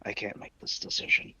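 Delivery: lightly voiced Dizzy character; a hint of the Dizzy character voice. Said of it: "You're still doing the Dizzy voice".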